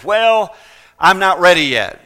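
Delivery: a hammed-up brush-off; mock excuse. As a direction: toss it off like a shrugging excuse. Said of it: "their excuse is".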